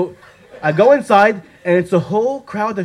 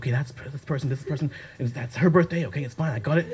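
much softer rehearsal of names; muttered to self; 3 semitones lower, 7 dB quieter, faster than usual. Retold or imitated. Memorising everyone's names, softly to herself. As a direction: memorise names under her breath.

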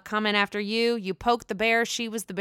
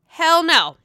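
sassy refusal; 8 semitones higher, 8 dB louder than usual. Retold or imitated. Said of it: "Lisa Rinna's like".

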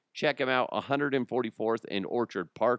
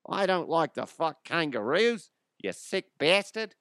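higher-pitched mock Aussie voice; Australian accent; 5 semitones higher than usual. Aussie attacker scolding the host over kangaroo joke, mock Australian accent. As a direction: protest as an imitation Australian, pitched up.

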